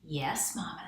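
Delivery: tiny whispered reply. Acted. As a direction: whisper it like an obedient little mouse. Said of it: "said the first little mouse".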